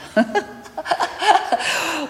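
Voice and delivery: a short embarrassed laugh; shy little chuckle. Real, not acted. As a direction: laugh it off lightly. Laughs before telling about husband.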